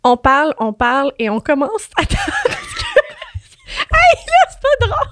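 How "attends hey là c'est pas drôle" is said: laughing. Real, not acted. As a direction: fight back laughter. Laughing at own story.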